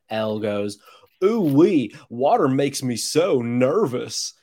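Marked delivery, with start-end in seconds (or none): 1.2-4.3 put-on Southern accent. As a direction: imitate a very Southern movie character, slowing down. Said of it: "in his very Southern accent".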